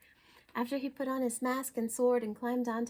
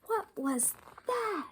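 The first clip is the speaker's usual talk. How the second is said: startled; 9 semitones higher, slower than usual. Acted. Kitten startled by mirror.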